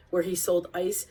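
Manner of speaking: plain reading voice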